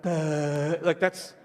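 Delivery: low, dull mock "Duh"; dim toddler imitation. Parent imitating a toddler who can't answer, in a low dull voice. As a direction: play a slow kid who can't answer. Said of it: "they're like".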